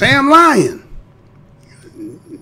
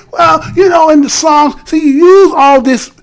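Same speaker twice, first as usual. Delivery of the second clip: mock teacher voice; 7 semitones higher than usual. Mocking the teacher scolding the students, pitched up. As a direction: play the preachy teacher, voice raised.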